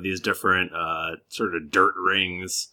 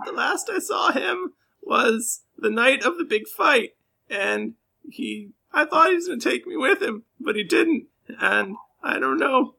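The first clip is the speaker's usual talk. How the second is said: very high, crying, fast rambling; weepy whine; 13 semitones higher than usual. Acted. Jilted girlfriend tells the adventurers about her boyfriend, weeping. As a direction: snivel out what you remember.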